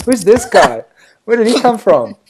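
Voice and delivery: retold bewilderment, pitched well up; baffled disbelief. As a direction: act out not recognising your own voice. Hearing his own recorded accent.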